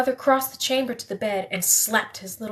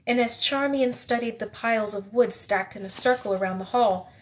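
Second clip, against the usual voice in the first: plain reading voice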